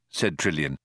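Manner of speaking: ordinary delivery, nothing marked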